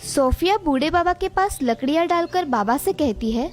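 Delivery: ordinary delivery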